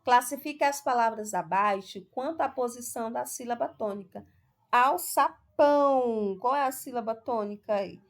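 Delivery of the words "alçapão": Alçapão with PÃO loud and long; stress on PÃO. Shown as a demonstration. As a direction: stretch out the final PÃO of Alçapão. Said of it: "falamos a palavra, pronunciamos que é a sílaba pão". (Alçapão com PÃO forte e alongado)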